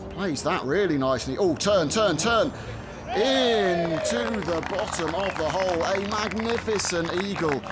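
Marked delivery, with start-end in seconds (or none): 1.4-5.8 rising urgent call as the ball rolls; excited. Real, not acted. Ball rolling toward hole, eagle.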